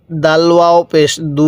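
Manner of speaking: slow spelled reading with the long উ of দু held; one-alif stretch. Shown as a demonstration. Teacher showing Quran reading rule: slow held দু. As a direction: draw out দু for one alif, slowly. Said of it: "এখানেও এক আলিফ টান দিব".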